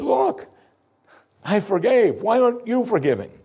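high, exasperated outburst; dismayed master demanding why; upset lord. Acted. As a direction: plead in disbelief, voice up high.

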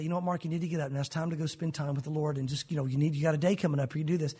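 low, understated prompting; inner nudge. Acted. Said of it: "I'll begin to hear that tug".

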